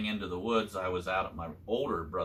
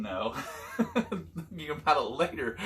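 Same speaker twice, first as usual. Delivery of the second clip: laughing; 6 semitones higher than usual. Real, not acted. Laughing at own hunting story.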